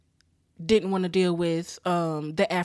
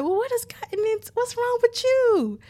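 mock indignant mother; 3 dB louder than usual. Mother asking her daughter what's wrong, high mock indignation. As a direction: imitate an appalled mom, pitched way up. Mother's shocked reply voiced.

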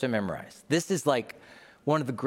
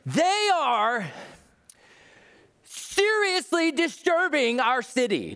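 high shrill scandalized whine, slowed; hammed-up shocked complainer; outraged townsfolk; 14 semitones higher, slower than usual. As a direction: gasp it like a horrified gossip. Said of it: "Don't you love it".

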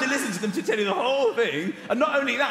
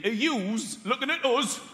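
slow, higher Geordie challenge; Geordie accent; 4 semitones higher, slower than usual. Retold or imitated. Geordie alone with him in a room, Geordie accent. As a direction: ask it in broad, drawn-out Geordie. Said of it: "I met a Geordie quite recently".

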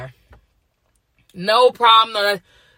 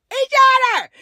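shouted again; 3 dB louder than usual. Real, not acted. Repeated shouted insistence.

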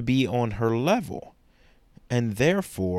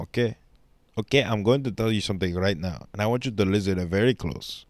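fast stern lecture; faster than usual. Acted. Mock stern lecture to viewer.

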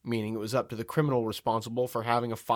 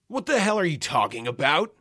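higher, irate outburst at moderate volume; angry roar; 5 semitones higher, 3 dB louder than usual. A narrator's reading. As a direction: roar in indignation without full volume. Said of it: "he roared".

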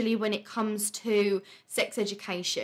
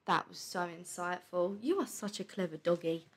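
talking to the dog; 4 semitones lower, 8 dB quieter than usual. Real, not acted. Cooing to her dog Tilly.

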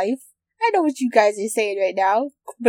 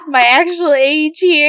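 laughing through the words, loud; giggling while talking; 5 semitones higher, 9 dB louder, slower than usual. Real, not acted. Laughing at herself.

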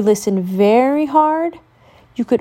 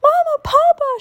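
very high frightened call for parents; panicked little cub calling out; scared cry. Acted. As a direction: cry out for Mama and Papa in fright. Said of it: "she cried".

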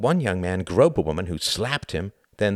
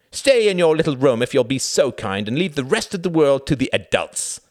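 high, loud, withering dismissal; mock politeness with raised, emphatic force; cutting scorn; 8 semitones higher, 7 dB louder than usual. A narrator's reading. Reginald wounding his brother Tom, scornful. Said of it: "His eyes danced with pleasure".

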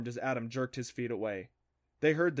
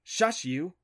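sharp, higher-pitched shushing; a touch of irritation, curt and raised; snapped; 6 semitones higher, 4 dB louder than usual. A narrator's reading. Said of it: "she snapped".

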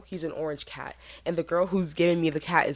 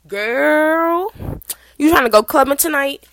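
high, very loud, stretched-out holler; rowdy party girl; 9 semitones higher, 11 dB louder, slower than usual. Retold or imitated. Freshman calling her friend once parents leave, loud rowdy holler. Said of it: "And then she called her friend".

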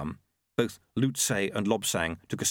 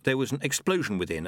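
plain reading voice

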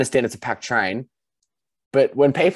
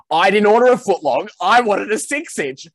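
loud, high-pitched mock tirade; angry outburst; 11 semitones higher, 7 dB louder than usual. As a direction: imitate an indignant customer cracking it. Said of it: "this guy in the suit cracked it".

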